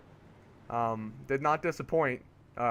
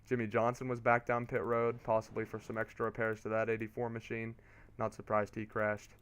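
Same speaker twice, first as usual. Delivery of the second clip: usual speaking voice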